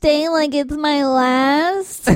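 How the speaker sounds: poised pageant contestant answer; sweet beauty queen voice. Acted. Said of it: "That was my pageant voice".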